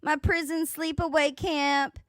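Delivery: high, loud mocking impersonation. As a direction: ham up her bragging, loud and high.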